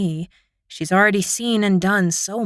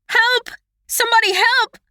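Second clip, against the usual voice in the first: shout for help. A narrator's reading. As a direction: yell down the halls for help. Calling for help.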